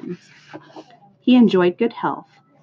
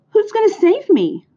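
high, loud pleading question; acted squeal of anxious hope; urgent cry; 5 dB louder than usual. A narrator's reading. Pig asks Charlotte who will save him, cried. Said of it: "cried Wilbur".

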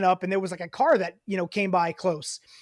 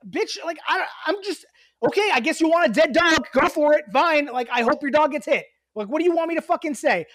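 exasperated venting; 7 semitones higher, 3 dB louder than usual. Retold or imitated. Venting at the neighbor.

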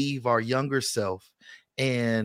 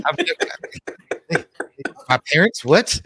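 higher and louder, indignant double-take; mock shocked disbelief; 5 semitones higher, 6 dB louder, faster than usual. Retelling child's accusation to friends, mock shocked disbelief. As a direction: act shocked like you've been framed.

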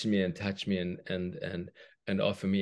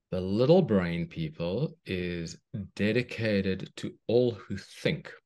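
put-on reading to children; 3 dB louder than usual. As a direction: read it like a bedtime story. Reads a children's story aloud.

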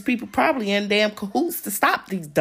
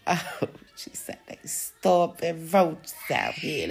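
whispered mock quote; 3 dB quieter, slower than usual. Viewers mocking her, whispered aside.

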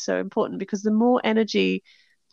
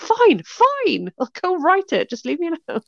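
higher, exasperated fine, fine; pitched-up mock exasperation, shooing the idea away; exasperated giving in; 9 semitones higher than usual. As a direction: huff it out like someone pestered in the shower. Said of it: "I was like".

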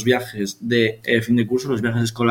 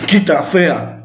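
mock rude shove; 6 semitones higher, 4 dB louder, much slower than usual. Quoting friend shoving her.

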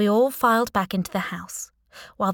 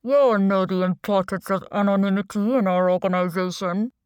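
disguised voice, mock courtesy; anonymous boss on a video call; deep, distorted voice; 4 dB louder than usual. A narrator's reading. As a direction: speak politely through a voice changer. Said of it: "distorted voice".

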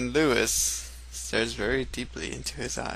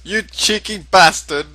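mock outrage; 6 semitones higher, 9 dB louder than usual. Acted retort to the villain.

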